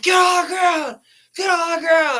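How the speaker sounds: high, loud screamed command; imitated police shout. Retelling police ordering two boys down at gunpoint, mock screaming. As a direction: yell it like a cop giving orders.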